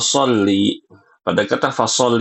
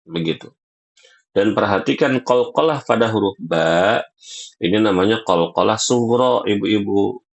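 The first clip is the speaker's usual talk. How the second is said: normal talking voice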